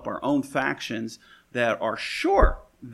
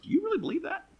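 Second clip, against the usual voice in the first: mocking disbelief, pitched way up; incredulous scoff; faster than usual. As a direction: play the stunned onlooker scoffing. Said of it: "people look at you and go".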